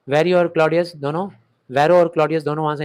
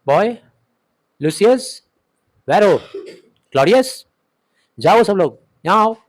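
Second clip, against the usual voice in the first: louder, higher calls across the tent; calling out; 4 semitones higher, 6 dB louder, slower than usual. Acted. Brutus calling servants to wake, loud calls. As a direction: summon the sleeping servants.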